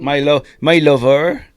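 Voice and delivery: loud, slow, hammed-up Bristol accent; drawn-out West Country caricature; mock Bristolian. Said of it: "like Bristolians or anything like that".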